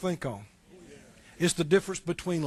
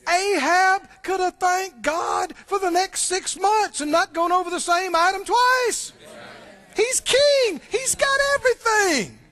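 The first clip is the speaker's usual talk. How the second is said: preaching shout; 10 semitones higher than usual. Real, not acted. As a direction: shout it out to the congregation.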